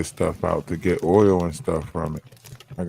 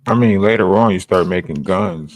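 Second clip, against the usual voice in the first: loud; 9 dB louder than usual. Real, not acted. Sudden loud explaining.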